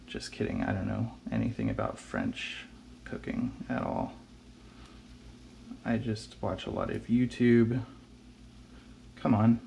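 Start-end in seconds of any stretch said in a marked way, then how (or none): 0.0-4.1 unvoiced, soft confession; whispered aside. Real, not acted. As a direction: whisper it like a secret. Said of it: "whispered aside after joke".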